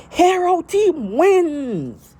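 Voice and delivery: very high, slow, drawn-out hero announcer; All Might announcement. Retold or imitated. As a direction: stretch it out like a booming hero. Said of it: "All Might going".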